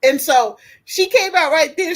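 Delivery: ordinary delivery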